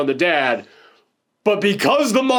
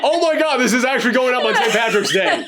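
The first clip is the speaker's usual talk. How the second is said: high, laughing disbelief; genuine surprise; 6 semitones higher than usual. Real, not acted. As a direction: crack up as the coincidence dawns on you. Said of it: "this is genuine reaction right here".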